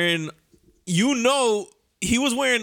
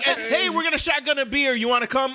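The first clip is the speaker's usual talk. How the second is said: mock coworker; 7 semitones higher than usual.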